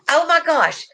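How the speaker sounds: high, delighted exclamation; real happy surprise; excited. Real, not acted. Delighted to see an old viewer.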